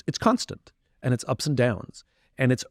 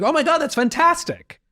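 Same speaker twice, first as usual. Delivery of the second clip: high, bright gushing delight, much higher; 4 dB louder than usual. Retold or imitated. Telling a friend how he reacts to being asked, thrilled high gush. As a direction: squeal it like the best news ever. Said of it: "I'm like".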